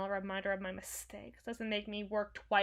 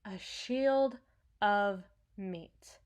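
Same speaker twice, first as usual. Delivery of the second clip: ordinary talk, nothing marked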